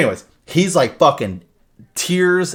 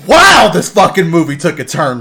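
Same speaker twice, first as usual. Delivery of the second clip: loud, high retold astonishment; reliving amazement at full volume; amazed exclamation; 6 semitones higher, 11 dB louder than usual. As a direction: exclaim it, blown away by the twist. Acting out own amazed reaction.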